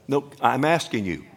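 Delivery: normal talking voice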